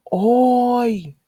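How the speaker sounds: loud high Oi! called to a boat; calling out. Shown as a demonstration. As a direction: shout Oi! as if hailing a boat. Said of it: "as if you are calling out to the boat".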